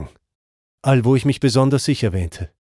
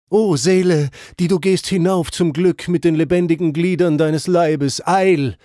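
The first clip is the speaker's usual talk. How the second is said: calling after; 5 semitones higher than usual. A narrator's reading. Crowd of souls cries out after Dante, urgent pleading. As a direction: call urgently after the living man. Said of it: "riefen sie mir nach".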